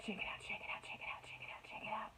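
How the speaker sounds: quick whispered chant of shake it out; whispering. Shown as a demonstration. Teacher hushing the class into a whispered chant. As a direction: whisper it fast, like a secret game.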